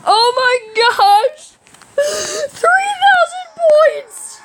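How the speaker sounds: high, slow, squealed amazement; astonished. Real, not acted. Gamer stunned by three thousand points, astonished. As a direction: wail it out in disbelief.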